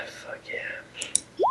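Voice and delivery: breathy whispered fuck yeah; under the breath, pumped; whispered victory at the dice; whispered cheer. Real, not acted. Sarcastic after rolling.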